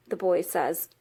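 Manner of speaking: ordinary delivery, nothing marked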